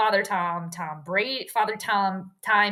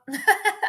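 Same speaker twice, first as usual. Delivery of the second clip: high, relieved burst of laughter; short high-pitched laugh; 10 semitones higher than usual. Real, not acted. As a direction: laugh off a near miss. Relief after a near spill.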